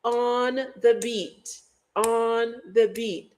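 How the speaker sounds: slow, even, on-the-beat delivery; monotone, very slow and steady; monotonous beat. Shown as a demonstration. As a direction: tap out each word like a metronome. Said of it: "Mom said it's monotonous".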